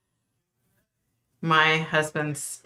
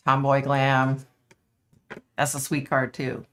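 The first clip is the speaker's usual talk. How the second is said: ordinary delivery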